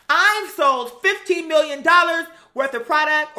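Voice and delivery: loud, high, slow boastful mock voice; mock bragging. Mocking Kim's sales boast, loud and high. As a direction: brag it up big and slow. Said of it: "And then she".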